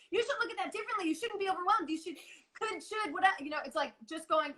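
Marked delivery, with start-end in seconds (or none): 0.1-3.3 higher, preachy nagging "you should" voice; mock scolding inner voice. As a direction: nag yourself in a lecturing tone, pitched up. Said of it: "which is why it's not like".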